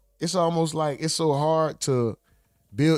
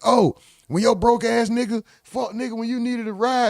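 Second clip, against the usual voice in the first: mocking friend; 6 semitones higher than usual.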